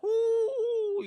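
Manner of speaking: high loud mock dog whimper; dog whine. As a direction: whine like a dog sitting on a nail. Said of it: "just, like, whining".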